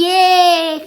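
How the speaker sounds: loud, high cheering cry; excited cheer. Real, not acted. Cheering on a cat fight.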